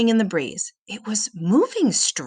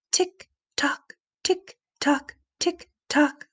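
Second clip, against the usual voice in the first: eerie clock tick; 7 semitones higher, slower than usual. A narrator's reading. Clock sound changes eerily.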